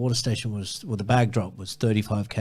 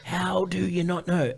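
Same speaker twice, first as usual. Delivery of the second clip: high, incredulous retold outburst; mock-exasperated, pitched well up; pissed off; 8 semitones higher than usual. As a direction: imitate yourself fed up and demanding. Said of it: "I was just so pissed off".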